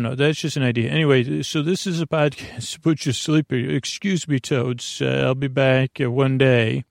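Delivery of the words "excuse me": ordinary talk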